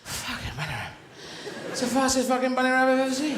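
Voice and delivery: mock post-race disbelief; exasperated racing greyhound. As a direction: start as a beaten dog, then burst out incredulous. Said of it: "'cause they'll be going".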